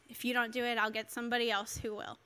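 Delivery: low, soft, matter-of-fact voice of God; God speaking. Retold or imitated. God warning a hesitant young preacher, low and soft. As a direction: quote God quietly, dropping low. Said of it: "I heard God say".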